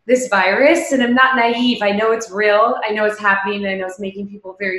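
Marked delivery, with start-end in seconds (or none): none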